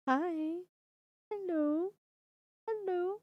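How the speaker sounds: high cooing pet voice. Real, not acted. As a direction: coo up high at the cat. Greeting cat in baby voice.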